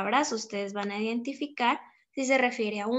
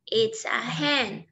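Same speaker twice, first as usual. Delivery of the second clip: put-on slow, clear answer for children to copy; slow model answer; slower than usual. Shown as a demonstration. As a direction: say it slowly so they can repeat it.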